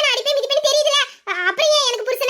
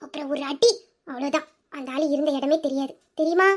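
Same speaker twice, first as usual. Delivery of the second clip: low, quiet, menacing build-up; ominous hush; 8 semitones lower, 6 dB quieter than usual. Acted. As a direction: drop low and soft to make him sound fearsome. Building up a terrifying protector. (பயமுறுத்தும் தாழ்ந்த குரல்)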